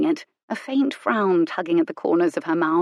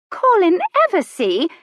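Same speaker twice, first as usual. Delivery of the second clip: high, loud outraged countess cry; indignant cry; 13 semitones higher, 6 dB louder than usual. A narrator's reading. Countess recognising Colin, high and indignant. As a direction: snap his name out in shocked indignation. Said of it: "She actually sounded indignant".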